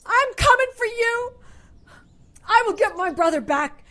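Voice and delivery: defiant shout. Acted. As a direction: yell across the park to her brother's captor.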